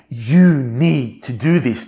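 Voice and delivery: slow, weighty insistence; emphatic. Retold or imitated. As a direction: press each word on the listener slowly. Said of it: "the, the emphatic tone of his voice".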